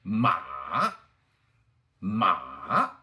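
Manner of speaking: MA dipping down, then running back up; long dip-and-rise MA; third tone. Shown as a demonstration. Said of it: "running down to a canyon and dipping".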